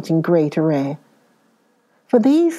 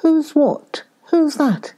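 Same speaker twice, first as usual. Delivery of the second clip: high, surprised questions; startled; 8 semitones higher, slower than usual. A narrator's reading. Nephew startled by an unexpected visitor, high surprised questions. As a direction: peer at the stranger, high and startled. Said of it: "cried Fred".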